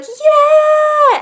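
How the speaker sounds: a high, loud cheering yeah; crowd cheer. Retold or imitated. Imitating the crowd cheering as the band comes on, loud and high. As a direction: whoop it like a crowd greeting the band.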